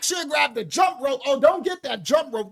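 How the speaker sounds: ordinary talk, nothing marked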